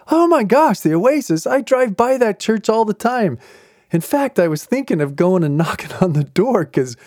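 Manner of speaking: high-pitched imitation of an eager seatmate; all excited. Seatmate recognizing a friend's church, all excited. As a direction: burst out amazed, then keep gushing. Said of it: "he's all excited about that".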